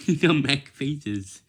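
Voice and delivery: loud, high and slow, straight after laughing; laughing admonishment. Real, not acted. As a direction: scold through real amusement. Admonishing while laughing at faces.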